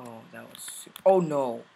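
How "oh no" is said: sudden, very loud cry of dismay; alarmed shout. Real, not acted. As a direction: cry out as the game goes wrong. Game goes wrong mid-sentence.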